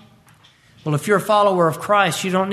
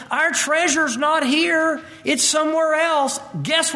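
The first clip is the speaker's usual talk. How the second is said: high emphatic preaching; 9 semitones higher than usual. Acted. Rising preaching peak.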